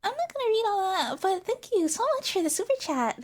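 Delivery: high, loud, chirpy brush-off; mock-sweet streamer squeal; dismissive cute streamer. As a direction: brightly blow off the fan in a put-on idol voice. Acts the streamer brushing off the fan.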